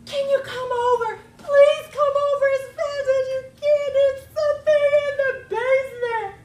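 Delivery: high, loud frantic begging; lady phoning her best friend, panicking; panicked plea. Acted. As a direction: beg for help in a panic. Scared lady phones friend.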